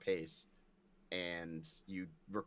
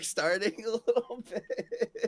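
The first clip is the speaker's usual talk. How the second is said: high, loud, drawn out through laughter; laughing; 11 semitones higher, 5 dB louder, slower than usual. Real, not acted. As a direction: break into laughter as you finish. Laughs through the end of the remark.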